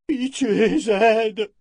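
high, loud desperate plea; pleading cry. Acted. Terrified men crying out to Zed for pity, high and loud. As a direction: beg for mercy at the top of the voice. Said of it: "crièrent quelques hommes".

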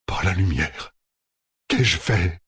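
understated, shaken realization; dawning horror. A narrator's reading. General studies maps and falls apart, in quiet horror. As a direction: let the horror sink in without raising the voice. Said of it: "Puis il se décomposa".

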